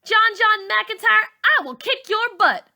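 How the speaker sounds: high loud furious threat; angry threat. A narrator's reading. Char threatening John John, furious and loud. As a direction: go after him with your voice.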